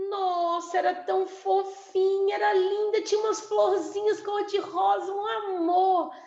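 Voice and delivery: high, loud gushing over a cute bottle; gushing talker. Acted. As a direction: rave about it like it's the cutest thing ever. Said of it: "se ele pergunta pro oral".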